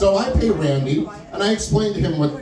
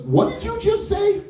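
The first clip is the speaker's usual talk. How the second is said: high-pitched, incredulous demand; indignant challenge. Retold or imitated. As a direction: imitate a friend squaring up for a fight. Friend squares up over an insult.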